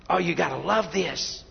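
usual speaking voice

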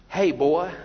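put-on a light Southern call of hey boy; lightly voiced Southern taunt from the Philistines; Southern drawl. Preacher voicing Philistines taunting Jonathan, light Southern twang. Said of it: "That's the Southern translation".

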